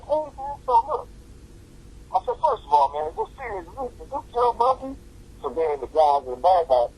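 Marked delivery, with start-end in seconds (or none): 0.0-4.9 pitched-up imitation of the call; comic high-voiced retelling; high mock voice. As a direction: squeak it out in disbelief. Retold excited phone exchange.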